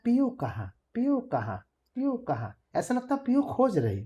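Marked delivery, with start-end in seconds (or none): 0.0-2.6 lightly imitated bird call, climbing high; पपीहे की पुकार की नकल; bird call words. Shown as a demonstration. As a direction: call out like the papiha, a little higher each time. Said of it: "तो ऐसा सुनाई देता है".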